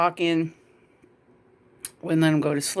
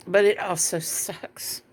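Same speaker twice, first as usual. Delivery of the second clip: tearful; 4 dB quieter than usual. Real, not acted. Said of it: "Let's see if I can get through this".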